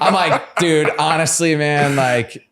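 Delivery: giggling lead-in; laughing. Real, not acted. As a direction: laugh while starting the answer. Laughing at own story.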